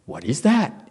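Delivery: soft, unbothered ask; cool calm question. Retold or imitated. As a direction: let it drop quietly. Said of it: "It's even cooler".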